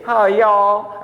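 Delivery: mimicked Texas Southern drawl. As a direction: greet like a drawling Texan. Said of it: "that's the Southern drawl".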